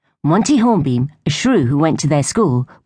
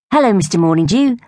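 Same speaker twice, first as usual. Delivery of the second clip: sing-song greeting, pitched higher; chorused greeting; 8 semitones higher, 3 dB louder than usual. A narrator's reading. Girls greeting Grandad and Reggie together. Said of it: "they chorused".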